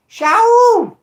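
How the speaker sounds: high, loud shout. Real, not acted. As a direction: belt it out. Shouted catchphrase callout.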